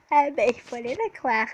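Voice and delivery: loud laughing aside. Real, not acted. Player admitting she should have believed, laughing. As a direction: chuckle it out.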